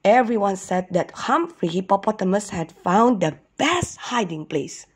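usual speaking voice

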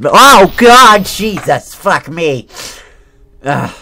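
high, loud panicked yelps; real fright, screamed; terrified outcry. Real, not acted. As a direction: shriek at the jump scare. Jump scare in horror game.

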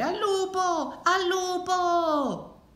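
very high, drawn-out false alarm; stretched, soaring yell; cry of wolf. A narrator's reading. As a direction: call it out long and high, as if to a whole village. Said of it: "cominciava a gridare".

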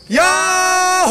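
long, high drawn-out yahoo; yodel shout. Acted. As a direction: call it out across the mountains. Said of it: "야호하는 거야".

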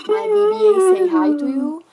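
loud high cartoon droid voice; toy voice. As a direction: make the droid say hi. Toy greets viewers.

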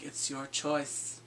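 slow, soft listless delivery; mock an emo deadpan, drawn out and quiet; emo, comatose Bran. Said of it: "All emo and comatose".

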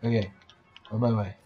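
ordinary talk

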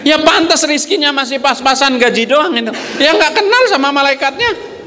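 high-pitched mock exasperation; excited teasing. Real, not acted. Preacher teasing congregation, high excited mock scolding. Mocking those who lack rizki.

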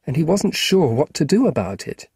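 plain reading voice

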